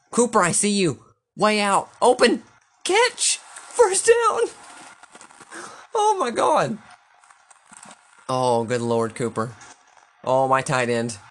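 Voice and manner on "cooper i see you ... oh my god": excited yelling. Real, not acted. Gamer yelling at receiver.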